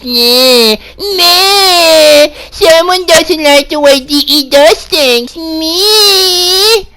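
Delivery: high, whining mock sob; fake-blubber like a spoiled kid; mock crying troll; mock crying whine. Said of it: "that's what you all motherfuckers sound like".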